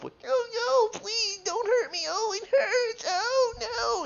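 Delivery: very high, wailing victim voice; hammed-up squeal of a small creature in pain; pleading creature. Game master voices the tortured fungus.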